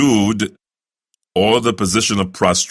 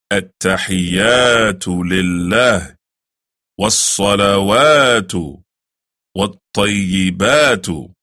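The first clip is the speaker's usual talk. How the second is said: slow recitation; slower than usual. Shown as a demonstration. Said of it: "This is when you will say".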